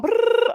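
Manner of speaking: high trilled brrr like a ringing phone; shrill mimicked ringtone; phone ring imitation. Imitates phone interrupting her.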